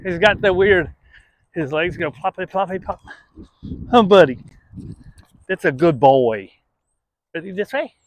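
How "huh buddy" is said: hyping the dog. Real, not acted.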